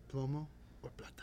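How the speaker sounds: whispered narco threat; menacing whisper. Pretend. To his lover, a whispered mock narco threat. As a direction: whisper it like a cartel boss. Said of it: "you're like".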